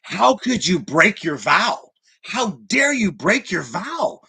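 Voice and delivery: indignant younger monk. Acted. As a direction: accuse the older monk with outrage. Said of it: "the younger monk ... says".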